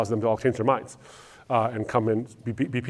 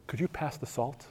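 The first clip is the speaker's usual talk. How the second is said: soft, mock-polite table talk; murmured politely, much softer; hushed polite request; 3 semitones higher, 11 dB quieter than usual. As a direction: imitate a quiet, courteous dinner guest. Imitating a silent, polite family dinner.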